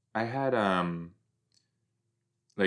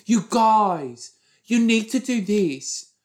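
high, loud preachy scolding; bossy Cleo impression; 9 semitones higher, 5 dB louder, slower than usual. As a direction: mimic a bossy, preachy kid. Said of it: "Cleo, who's constantly like".

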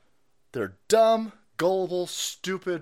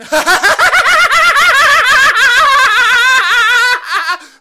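long, loud, high-pitched mock cackle; Kamala Harris cackle; 18 dB louder than usual. Host parodying a politician's laugh, shrieking cackle. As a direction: cackle wildly and far too long. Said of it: "renditions of Kamala Harris's speech patterns".

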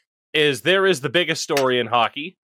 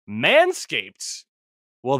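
normal talking voice

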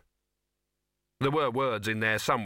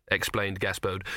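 plain reading voice

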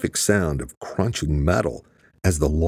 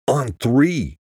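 higher-pitched, restrained yell; yelled count; 7 semitones higher than usual. A narrator's reading. Chaz readying to swing Luke clear, urgent yell. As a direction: call the signal out urgently. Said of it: "she yelled".

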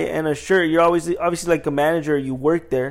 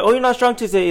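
higher, lightly mocking customer voice; teasing customer; 8 semitones higher, 3 dB louder than usual. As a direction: imitate a customer ribbing you. Repeats customers' teasing joke.

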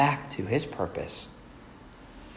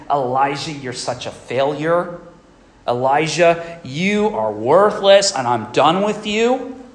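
rising into a loud, higher berating; mock scolding; 3 semitones higher, 6 dB louder, slower than usual. God voiced scolding Elijah, loud and rising. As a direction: berate him like a harsh judge. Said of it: "He's not yelling at Elijah".

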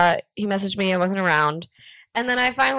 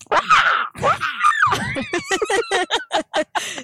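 pterodactyl noise. Shown as a demonstration. Friend showing off their best pterodactyl noise, high screech. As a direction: shriek like a pterodactyl. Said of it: "That's my best pterodactyl noise".

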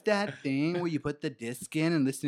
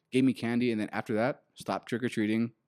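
usual speaking voice

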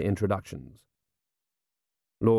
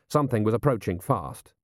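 usual speaking voice

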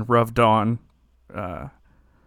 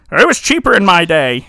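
higher, louder mock old-timer; grumbling boomer; 5 semitones higher, 8 dB louder than usual. A boomer grumbling about prices, mock-indignant. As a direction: complain loudly like a boomer. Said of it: "Go home, boomer".

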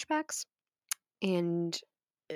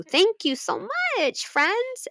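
sing-song thanks; 3 dB louder, much slower than usual. Real, not acted. Thanking chat.

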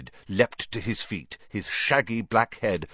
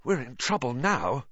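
mildly hoarse, raised, worried voice; 7 semitones higher than usual. A narrator's reading. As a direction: say it with a touch of huskiness. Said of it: "he said hoarsely".

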